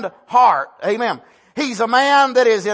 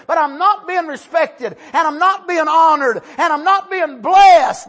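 loud, high, shouted plea; anguished shout; 6 semitones higher, 4 dB louder than usual. Retold or imitated. Barak pleading with God that he is in trouble, shouting. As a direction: cry out to God at full voice. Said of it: "he's saying".

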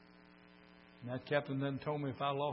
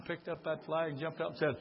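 normal talking voice

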